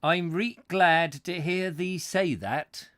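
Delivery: broad northern English dialect, drawn out; northern English accent. Acted. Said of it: "what accent that they have up in, up north".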